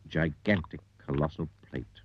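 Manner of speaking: ordinary delivery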